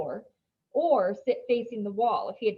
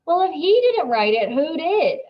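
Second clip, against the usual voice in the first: much louder, higher demand; 8 semitones higher, 10 dB louder than usual. A narrator's reading. Dylan demands to know who wrote it, loud. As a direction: demand an answer loud and high. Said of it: "asks Dylan".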